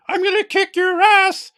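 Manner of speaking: cartoon toy-dog threat, high and slow; yappy chihuahua. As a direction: yap it like a little chihuahua picking a fight. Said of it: "like a little chihuahua going".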